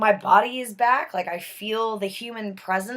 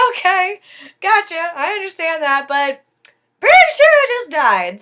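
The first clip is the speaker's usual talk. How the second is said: higher and louder, pressing the point; exasperated insistence; 7 semitones higher, 9 dB louder than usual. Retold or imitated. Woman insisting to her friend she died, loud and exasperated. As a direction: insist loudly he isn't listening.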